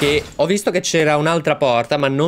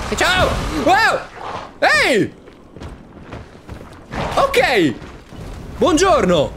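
startled greeting; much slower than usual. Real, not acted. Gamer startled by a charging enemy, squealing hello. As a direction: yelp hello at a surprise monster.

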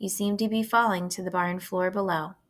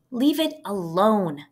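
firm command; 4 dB louder, slower than usual. A narrator's reading. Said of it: "commanded Fern".

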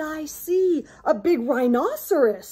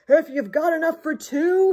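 loud, raised storybook tiger growl; 5 semitones higher, 8 dB louder than usual. A narrator's reading. Tiger pressing to be let in, lightly growling. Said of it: "he growled".

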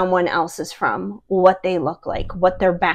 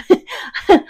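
short high laugh; 11 semitones higher than usual. Real, not acted. Laugh about long acronym.

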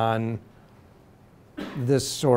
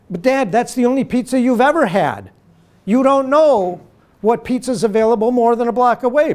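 exasperated son; 9 semitones higher, 6 dB louder than usual. Retold or imitated. Son arguing with father.

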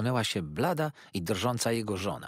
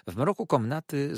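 ordinary delivery, nothing marked

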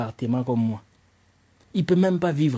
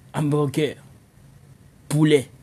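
usual speaking voice

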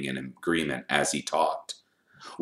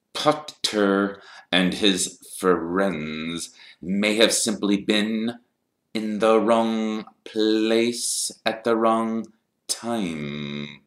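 higher, very slow, drawn-out insinuation; sneering Snape; 6 semitones higher, 4 dB louder, much slower than usual. A narrator's reading. Snape pretending to excuse Potter, slow and sneering. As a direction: stretch each word with mock doubt.

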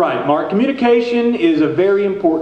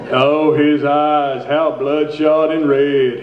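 slow dramatic recital; 5 semitones lower, slower than usual. Acted. Dramatic poem slowdown.

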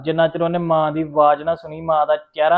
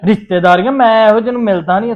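loud, higher scornful retort; angry retort; 7 semitones higher, 9 dB louder than usual. Acted. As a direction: throw the word back at her angrily. Angry retort about relatives.

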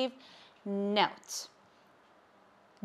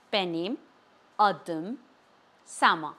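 "Benim", pause, "adım", pause, "Sama"; each of "Benim adım Sama" as its own deliberate unit; word by word; 4 semitones lower than usual. Shown as a demonstration. Teacher modelling "Benim adım Sama" for learners, word by word.

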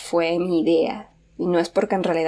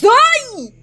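very high, loud yelp; sudden shriek of fright; startled yelp; 6 dB louder than usual. Real, not acted. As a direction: yelp as if jumped on. Jump scare.

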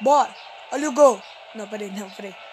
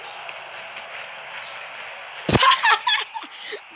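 breathy laugh. Real, not acted. Own goal in the game.

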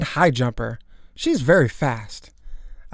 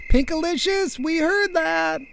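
parental warning. Acted. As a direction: call out that the child is caught.

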